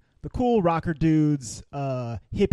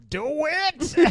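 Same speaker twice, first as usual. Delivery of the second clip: high, urging holler; mock audience cheer; 13 semitones higher than usual. Voicing the audience urging a kiss, high mock holler. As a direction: egg them on in a high call.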